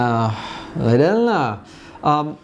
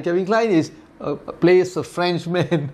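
ordinary talk, nothing marked

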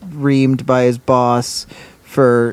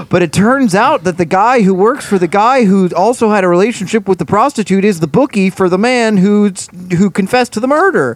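breathless, higher, hammed-up twist reveal; mock dramatic reveal; 6 semitones higher than usual. Mocking the show's endless twists, hammed-up and breathless. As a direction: play it like a soap plot twist. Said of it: "every turn being like".

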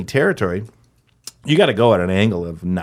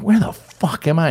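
raised pitch, mild comic bewilderment; bewildered; 6 semitones higher than usual. Acted. Newcomer landing in the desert, mildly bewildered. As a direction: ask it as if lost on Mars. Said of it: "you're like".

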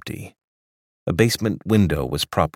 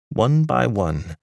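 plain reading voice

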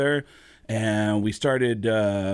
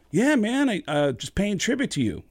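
high, eager opening that settles; eager deference; 9 semitones higher than usual. Retold or imitated. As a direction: hurry to please your idol. Flattered singer answers his idol.

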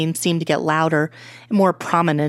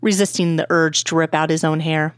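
plain reading voice

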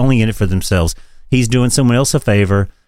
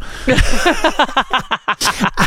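high laugh; 12 semitones higher than usual. Real, not acted. Host laughing at his aunt's threat, high laugh.

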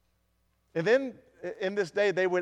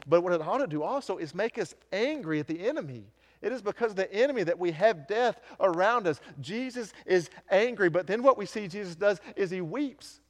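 preaching; 5 semitones higher than usual. Acted. Pastor urging the congregation at rising fervor. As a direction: build the sermon toward its peak. Preaching builds at full force.

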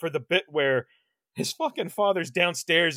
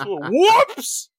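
high, loud comic "whoops"; imitated pratfall whoops; 7 semitones higher, 5 dB louder than usual. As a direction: cry out as if tumbling over. Imitating the tumble over the rail.

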